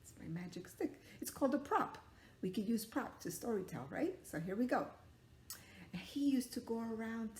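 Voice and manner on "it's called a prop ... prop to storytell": plain reading voice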